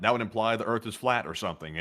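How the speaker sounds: ordinary delivery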